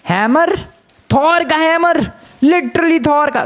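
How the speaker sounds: high-pitched thrilled disbelief; amazed excitement. Real, not acted. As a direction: exclaim an astonishing find. Finds what seems Thor's hammer.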